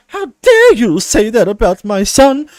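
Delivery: high, loud, offended mother voice; mock offended mum; outraged mum. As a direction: shriek it like an affronted mum. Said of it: "And his mum was like".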